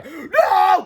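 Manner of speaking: high, loud warning yell; desperate cry. A narrator's reading. George warning the teachers, high loud cry. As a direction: yell to stop them. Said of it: "cried George".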